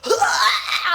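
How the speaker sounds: hammed-up high yelp; mock climax cry. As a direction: fake orgasm shout, laughing. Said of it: "he's like".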